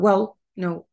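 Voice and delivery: "well" said quickly, without lengthening; quick, short. Shown as a demonstration. Teacher showing how speakers don't say it, quick "well". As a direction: cut "well" short, no drawn vowel. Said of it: "مش بيقولوها بسرعة".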